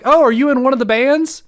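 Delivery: loud, high-pitched curious quote; eager retold question. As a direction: imitate an over-eager greeter at the door. Retold question from door staff.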